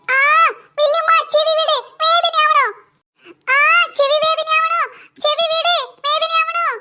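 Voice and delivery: high, pained pleading to let go; pained cry. Acted. Boy begging grandaunt to release his ear, pained and high. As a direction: yelp as the ear is pulled.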